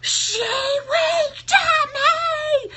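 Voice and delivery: ecstatic high-pitched fan shriek, drawn out; screaming. Retold or imitated. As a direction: reenact screaming it at the top of your lungs. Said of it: "screaming it so loud".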